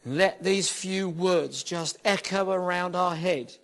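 rising and falling preacher's prayer, building in places; earnest worship-leader prayer, swelling on key words; fervent prayer. Acted. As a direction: pray it over the room with fervour. Reads scripture then prays over room.

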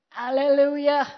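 loud, northern English hallelujah; northern accent shout. Retold or imitated. Preacher mimics Smith Wigglesworth's northern morning hallelujah, loud northern shout. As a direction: bellow it like a northern plumber getting out of bed. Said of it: "I know, northern".

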